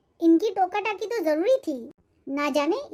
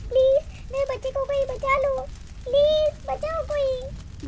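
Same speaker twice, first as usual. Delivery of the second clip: panicked screaming; 7 semitones higher than usual. Acted. Mother screaming for help as her baby is trapped in fire. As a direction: scream for someone to save the child.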